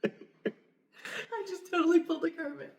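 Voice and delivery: laughing. Real, not acted. Cohost laughing at herself.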